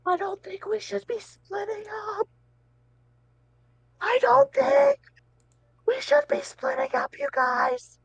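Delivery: very high, strained squeaky hushed yell; whisper shouting. Acted. Player urging the crew not to split up, whisper shouting. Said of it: "I'm, like, s-, whisper shouting".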